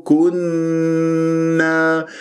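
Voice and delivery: very slow, level كنا with a long nasal hum; doubled noon ghunnah. Shown as a demonstration. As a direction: hold the nn of كنا, then release a long a. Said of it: "النون مشددة غنة".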